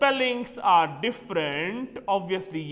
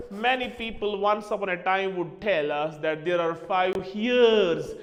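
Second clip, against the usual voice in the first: normal talking voice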